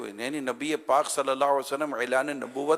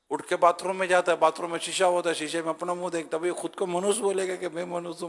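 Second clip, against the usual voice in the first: ordinary talk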